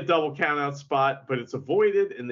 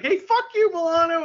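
mock taunt; 12 semitones higher, 6 dB louder than usual. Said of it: "He's like".

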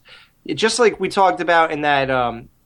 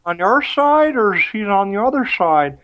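lightly slurred, higher mock drunk; mock cartoon drunk; 7 semitones higher than usual. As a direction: parody a bad actor playing drunk, pitched up. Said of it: "this fucking guy trying to act drunk".

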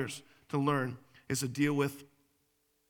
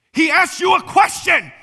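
high, explosive imitated shout; sudden full-volume mock outburst; retold yell; 18 dB louder than usual. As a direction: act out a spouse yelling back. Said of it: "he yelled, and he, and right back at her".

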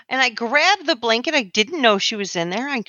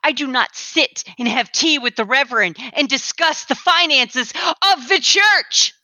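building indignation. Acted. Woman denies discussing church money with the Reverend, increasingly indignant. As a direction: build to an exasperated, stretched last phrase.

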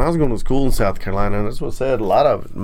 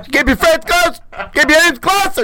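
high, loud East Coast street voice quoting; nineties East Coast accent; 3 dB louder than usual. Pretend. The accent game round, a loud East Coast street voice.

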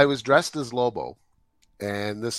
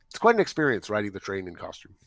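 normal talking voice

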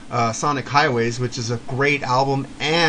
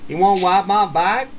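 put-on higher, louder Arkansas kid accent, "wipe" for ride; Arkansas drawl; 7 semitones higher, 7 dB louder than usual. Arkansas neighbor kid asking to ride his bike, higher, louder Arkansas accent. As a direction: imitate the Arkansas boy next door with a thick Arkansas twang. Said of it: "He's from Arkansas".

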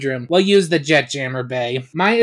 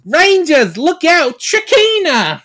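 much higher, loud urgent cry, stretched name; frantic warning shout; 12 semitones higher, 7 dB louder than usual. Acted. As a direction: scream a warning across the ship. Alpha warns the rangers.

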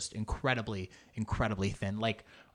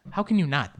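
usual speaking voice

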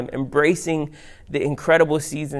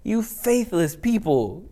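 frustrated, higher and drawn out; acted exasperation, slow and pitched up; Jesus; 6 semitones higher, slower than usual. Jesus scolding his disciples, frustrated. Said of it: "Jesus is frustrated. He's like".

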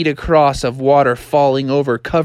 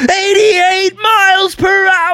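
very high, drawn-out yell; slow, screechy mock-triumphant shout; hammed-up shout; 4 dB louder than usual. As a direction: cry it out at full speed, stretching the last word. Acted shout while speeding along.

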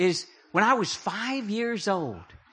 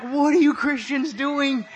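friends' exasperation; 5 semitones higher than usual. Retold or imitated.

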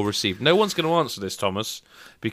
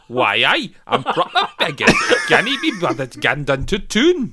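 put-on Geordie accent; 5 semitones higher, 4 dB louder than usual. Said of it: "I'm gonna have to do it again".

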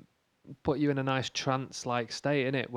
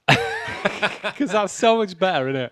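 excited; 6 semitones higher, 5 dB louder, slower than usual. Real, not acted. Enthused over a pun.

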